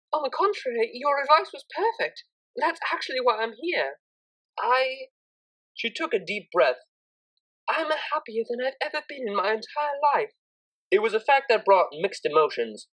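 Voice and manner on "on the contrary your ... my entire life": higher, earnest and heartfelt; grateful Octavia. A narrator's reading. Octavia tells Psyche how happy she is, earnest and higher. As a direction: say it with full sincerity.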